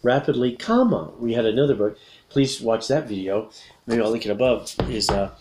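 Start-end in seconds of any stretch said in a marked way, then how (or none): 0.6-1.1 comma jumped high and sung out; stress on comma. Shown as a demonstration. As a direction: make comma pop up high. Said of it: "don't forget the comma".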